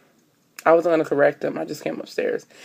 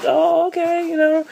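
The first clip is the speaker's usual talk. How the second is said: airy high fake-casual reply; high polite play-off; 11 semitones higher than usual. Covering her shock for the cousin, high and breezy.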